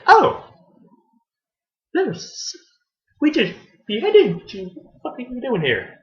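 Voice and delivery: high-pitched startled cry, then stunned; shocked disbelief. Retold or imitated. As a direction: gasp out the shock of seeing a dead woman return. Attackers see victim they beheaded.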